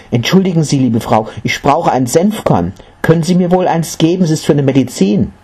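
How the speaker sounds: high, hurried polite asking; eager request. A narrator's reading. Mother asking a housewife for medicine, eager.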